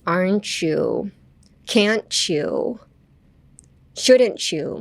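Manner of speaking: slow "aren't you", "can't you", "shouldn't you", each ending in "chew"; linked "t" plus "you". Shown as a demonstration. Teacher lists examples, linking "aren't you", "can't you", "shouldn't you" with ch. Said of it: "tで終わるときはchewっていう発音に".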